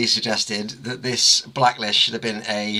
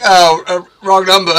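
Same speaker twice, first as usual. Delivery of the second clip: amused; 6 semitones higher, 7 dB louder than usual. Retold or imitated. Laughing retelling awkward reply.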